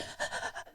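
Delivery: a shallow, unvoiced sobbing breath. Shown as a demonstration. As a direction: breathe like someone who has been crying. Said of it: "how do you breathe?".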